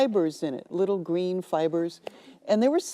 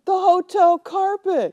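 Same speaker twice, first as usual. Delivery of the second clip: much higher, louder, slow whine; distraught bride; 12 semitones higher, 6 dB louder, slower than usual. Retold or imitated. Bride whining to the clerk about the carpet.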